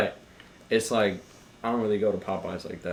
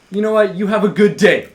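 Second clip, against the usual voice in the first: higher, louder snapped-back farewell; aggressive and angry; 9 semitones higher, 10 dB louder than usual. Retold or imitated. Barista to rude drive-thru customer, aggressive and angry. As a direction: throw it at them angrily. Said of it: "very aggressive and angry".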